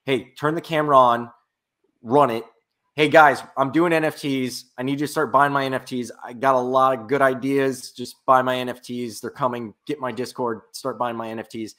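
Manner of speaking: ordinary talk, nothing marked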